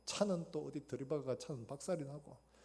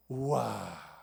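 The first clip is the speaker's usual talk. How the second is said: much louder astonished wow; amazed wow; 3 semitones lower, 10 dB louder, much slower than usual. Retold or imitated. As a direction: gasp out a wondering wow. Amazed at parting soup.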